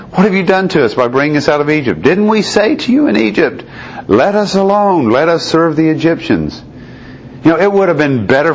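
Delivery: high, pleading, panicked complaint; terrified outcry. Acted. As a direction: cry out in terror at Moses. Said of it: "They were terrified and cried out".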